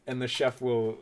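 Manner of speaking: ordinary talk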